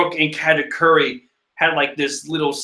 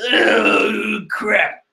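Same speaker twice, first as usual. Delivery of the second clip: higher put-on groaning voice; Chopper; 5 semitones higher than usual. Little crewmate in trouble, groaning in high character voice. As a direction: groan in a raised cartoon voice. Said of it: "Yeah, that's his voice now".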